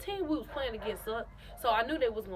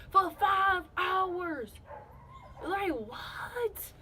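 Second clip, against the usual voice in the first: stretched-out disbelief; exasperated; 7 semitones higher, much slower than usual. Real, not acted. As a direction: drag it out, fed up. Complaining about hospital wait.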